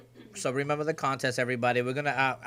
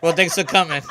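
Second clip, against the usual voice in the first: laughing through the words; teasing through laughter; laughing tease; 5 semitones higher, 7 dB louder than usual. Real, not acted.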